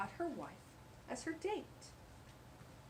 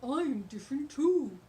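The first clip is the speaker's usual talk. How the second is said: dog voice; 4 semitones higher, 9 dB louder than usual. Acted. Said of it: "said Mister Paws".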